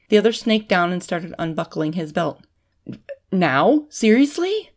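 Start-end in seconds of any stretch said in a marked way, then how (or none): 3.3-4.7 incredulous, rising higher on the second word; disbelief. A narrator's reading. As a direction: question it in mounting disbelief. Man baffled as belt is unbuckled.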